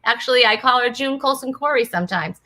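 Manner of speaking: ordinary delivery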